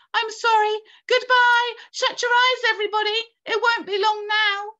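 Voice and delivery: sustained high, loud panicked cry. A narrator's reading. James to his friends before the crash, crying out. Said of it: "cried James".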